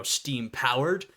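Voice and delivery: normal talking voice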